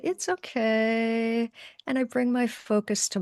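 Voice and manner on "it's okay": slow, soothing reassurance; calm the nervous voice inside, slowly; reassuring self-talk. Retold or imitated. Channeler soothing her own nerves: slow, gentle "It's okay". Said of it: "I say".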